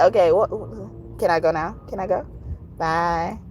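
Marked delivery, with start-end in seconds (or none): none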